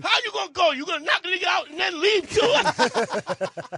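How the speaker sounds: high, loud shouted protest; acted indignant promoter. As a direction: imitate a promoter yelling at his act. Promoter refuses to let him leave.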